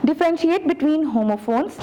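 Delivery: slow, deliberate reading of the syllabus goals; formal objectives read-aloud. Acted. Teacher reading the unit objectives to her students, slow and formal.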